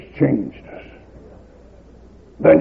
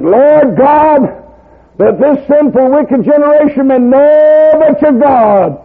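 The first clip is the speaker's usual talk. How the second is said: higher, louder, slow calling out; fervent prayer; 9 semitones higher, 6 dB louder, slower than usual. Retold or imitated. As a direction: cry out to heaven. Elijah calls on God.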